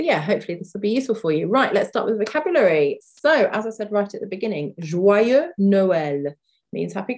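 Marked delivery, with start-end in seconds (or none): 4.8-6.3 said slowly, Joyeux Noël drawn out; slow teaching model. Shown as a demonstration. Teacher slowing down for students learning French. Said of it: "I'm gonna say this a few times".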